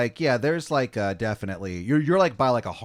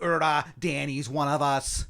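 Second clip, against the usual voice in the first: put-on higher, overdone Boston accent; awful Boston accent; 4 semitones higher than usual. Colonists welcoming Danny after the deed, bad Boston accent. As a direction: vouch for him in fake Bostonese. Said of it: "he says in that awful accent".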